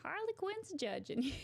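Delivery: high-pitched Brooklyn attempt gone Texan; badly faked Brooklyn twang; failed Brooklyn accent. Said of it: "Why is she Texas now?".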